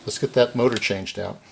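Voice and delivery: ordinary talk, nothing marked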